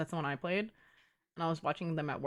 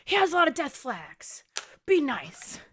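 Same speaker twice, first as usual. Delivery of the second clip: loud, high mock scream of protest; hammed-up screamed protest; imitated screaming; 7 semitones higher, 7 dB louder, slower than usual. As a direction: imitate a friend yelling in outraged defence. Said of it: "she started screaming".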